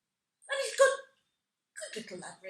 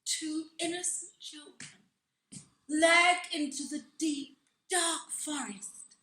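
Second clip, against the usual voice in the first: ordinary talk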